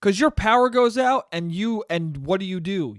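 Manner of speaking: ordinary delivery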